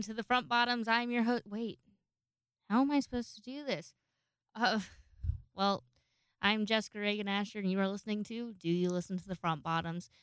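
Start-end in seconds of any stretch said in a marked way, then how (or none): none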